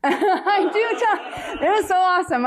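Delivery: high, slow laugh through the words. Real, not acted. Laughing after the interviewer says she talks like a sailor. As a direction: laugh it off, caught out.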